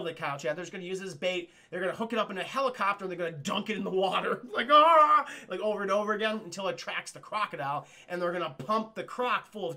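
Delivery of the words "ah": loud high ah scream; mock cow scream. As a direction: yelp as if dunked.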